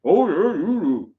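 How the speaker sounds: talking with mouth full. Real, not acted. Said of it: "I sounded a bit like Father Christmas then".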